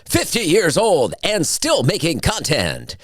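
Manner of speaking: hammed-up tragic biopic narration over music; sad documentary intro. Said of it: "that was an intro that I hope doesn't make it".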